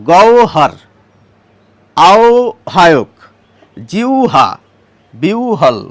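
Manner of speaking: গওহর, আহ্বায়ক, জিহ্বা, বিহ্বল with o or u before h; words said slowly with the o/u-then-h sound; old pronunciation. Shown as a demonstration. Teacher listing words in the old pronunciation. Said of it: "উচ্চারণও এইভাবে হবে".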